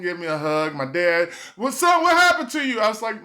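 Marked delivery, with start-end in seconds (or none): 1.6-2.8 much higher, louder dad voice; lightly voiced dad questioning. A dad asking his late son, much higher and louder.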